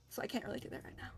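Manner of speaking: normal talking voice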